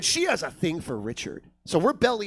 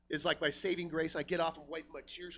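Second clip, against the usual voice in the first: normal talking voice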